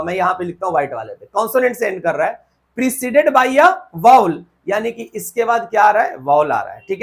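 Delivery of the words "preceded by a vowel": reading the rule out slowly, word by word; teacher dictating a rule. Shown as a demonstration.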